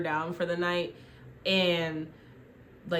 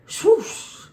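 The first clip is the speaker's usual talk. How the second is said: high, loud whooshing spear noise; sharp imitated whoosh; whoosh sound; 14 semitones higher, 11 dB louder than usual. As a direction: make the spear fly past. Spear flying sound effect.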